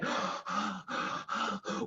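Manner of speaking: unvoiced panting gasp; breathless gasp. A narrator's reading. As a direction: gasp for air while digging. Mr Fox out of breath.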